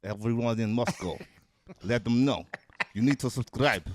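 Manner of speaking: a light put-on Russian accent; mock Russian accent. Podcast guest asked for Russian, addressing the people of Moscow in a mild Russian accent. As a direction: play a Russian telling Moscow to subscribe. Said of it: "you know who we need to subscribe?".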